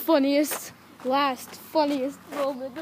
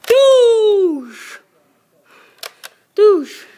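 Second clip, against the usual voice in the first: high, loud falling blast noise; imitated gunshots; 7 semitones higher, 10 dB louder than usual. Soldier shooting in a pretend battle, loud gunshot noises. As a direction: boom out the play-gun shots.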